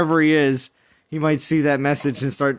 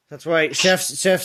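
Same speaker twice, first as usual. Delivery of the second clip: usual speaking voice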